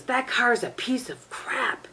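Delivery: lightly hammed-up annoyed husband; grumpy husband. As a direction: imitate the husband griping. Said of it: "he goes".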